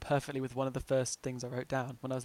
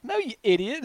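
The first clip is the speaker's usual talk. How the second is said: mock exasperation; 8 semitones higher, 7 dB louder than usual. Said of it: "I was like".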